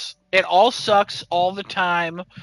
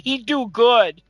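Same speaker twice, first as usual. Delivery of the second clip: higher-pitched mock simpleton; dim-witted writer; 3 semitones higher, slower than usual. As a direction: play a clumsy, barely literate coworker. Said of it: "a bunch of stupid shit".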